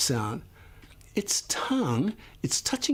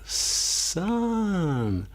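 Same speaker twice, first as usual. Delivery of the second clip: stretched slowly; 4 semitones higher than usual. Shown as a demonstration. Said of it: "if we say it like a turtle".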